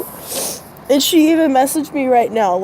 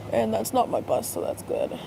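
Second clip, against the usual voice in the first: whispered aside; 10 dB quieter than usual. Real, not acted. Muttered aside waiting for bus.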